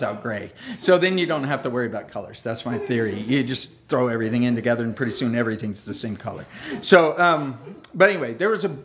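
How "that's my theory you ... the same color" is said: ordinary delivery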